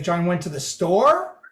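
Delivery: store lifted at the end like a question; questioning rise on STORE. Shown as a demonstration. Lecturer showing changed meaning, rising question on store. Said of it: "That changes the whole meaning".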